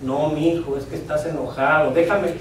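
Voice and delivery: low, soft, soothing wife; good wife calming. Acted. Good wife calms her angry husband, soft and placating. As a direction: gently talk an angry husband down. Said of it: "como toda buena esposa".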